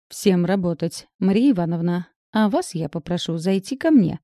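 usual speaking voice

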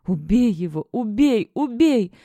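frantic shout. A narrator's reading. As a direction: scream it as if delirious. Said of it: "повторяла Кора, словно в бреду". (исступлённый крик, нарастающий)

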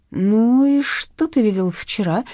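slow suspicious question. Acted. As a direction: drag it out with suspicion. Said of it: "подозрительно осведомился брюнет". (подозрительно)

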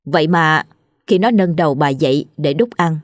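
ordinary delivery

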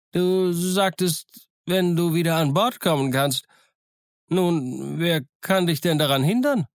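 a half-wild hermit probing suspiciously; reedy island hermit; castaway voice. Acted. Castaway asks Jim suspiciously.

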